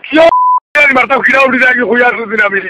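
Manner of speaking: usual speaking voice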